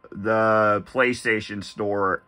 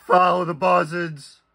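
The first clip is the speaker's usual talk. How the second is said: lightly voiced sinister cult leader; creepy cult leader voice; 4 semitones higher than usual. Bray Wyatt catchphrase in creepy voice.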